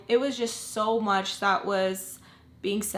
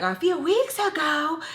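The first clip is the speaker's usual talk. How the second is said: high, slow, disbelieving question; stretched-out high-pitched shock; incredulous; 8 semitones higher, 4 dB louder, slower than usual. Retold or imitated. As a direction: echo it back in outraged disbelief. Retold shocked reaction to the news.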